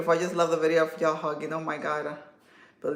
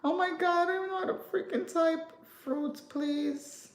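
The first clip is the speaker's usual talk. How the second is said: much higher, exasperated at her own typing; frustrated squeal; 12 semitones higher, 4 dB quieter than usual. Real, not acted. Creator exasperated while typing a message to her partner. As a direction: fumble and whine at the keyboard.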